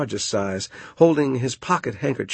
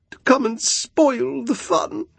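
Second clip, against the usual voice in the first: peeved Moon voice; 14 semitones higher, 3 dB louder than usual. A narrator's reading. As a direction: pout it out like an offended Moon. The Moon's complaining voice.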